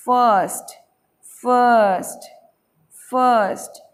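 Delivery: फर्स्ट as fuhhst, long vowel, no r; silent r. Shown as a demonstration. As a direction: stretch the vowel in each फर्स्ट. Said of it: "आर silent हो जाता है".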